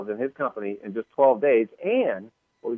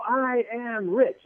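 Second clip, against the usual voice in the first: slow, flat crowd recital; mock sheepish crowd chant; crowd drone; 10 semitones higher, 3 dB quieter, much slower than usual. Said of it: "And they go".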